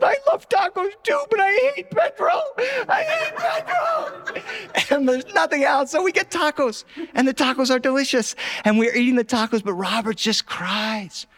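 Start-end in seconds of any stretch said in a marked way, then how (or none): 0.0-5.0 high, sobbing yell, dragged out at the end; shout-cry it like a furious child; wailing shout. Retold or imitated. Robert wailing about Pedro, high sobbing shout.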